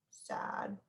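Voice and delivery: soft unvoiced sad; a hushed sad face voice; whispered sad. Shown as a demonstration. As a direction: breathe the word sad like a sigh. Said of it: "it has to match".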